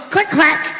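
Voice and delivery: vocal shotgun pump, click clack; shotgun click imitation. Shown as a demonstration. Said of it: "That's a test".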